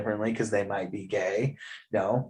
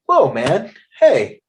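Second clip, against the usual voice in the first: much higher and louder, taken-aback outrage; startled, offended pushback; mock indignant protest; 10 semitones higher, 6 dB louder than usual. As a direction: play it shocked that someone yelled at you. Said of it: "they would be like".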